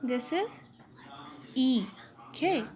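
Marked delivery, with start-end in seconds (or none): 1.5-1.8 "E" as a lone long front vowel, as in beat; vowel demonstration. Shown as a demonstration. Teacher showing the first front vowel, "E" sounded alone. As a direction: hold "E" by itself, the vowel of beat. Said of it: "Front vowels".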